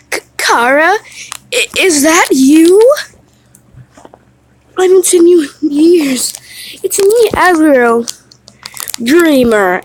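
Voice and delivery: put-on a creature meeting an old friend again; breathless, stammering disbelief; amazed goat voice. Goat recognises long-lost friend.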